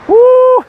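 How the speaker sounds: a high, loud laugh breaking into a whoop. Real, not acted. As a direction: crack up and let out a high woo. Reading a cheeky viewer comment.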